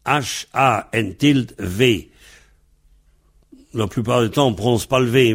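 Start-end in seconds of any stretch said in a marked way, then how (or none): none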